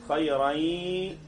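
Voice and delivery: slow, drawn-out nasal hum in خيرين; held ghunna. Shown as a demonstration. Teacher showing where the sound sits, long ghunna on خيرين. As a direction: let the nasal sound ring through خيرين. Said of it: "مسكت الحتة دي هتلاقي فيها صوت".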